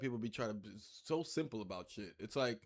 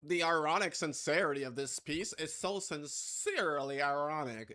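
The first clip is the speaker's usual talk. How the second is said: lightly put-on art critic voice; art person; 2 semitones higher than usual. Player mocking an art person's verdict on a piece, hint of pretentiousness. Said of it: "If that isn't an art person".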